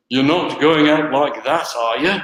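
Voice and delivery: put-on a light British accent, stern dad to teenage daughter. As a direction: do the father-in-law's British accent, gently mocking. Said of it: "in his British accent".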